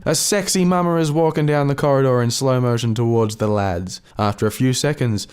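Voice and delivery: normal talking voice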